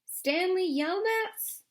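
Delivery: high, slow call across the lake. A narrator's reading. Lawyer calling to the boy in the hole, high and drawn out. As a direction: call his name from a distance. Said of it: "she called".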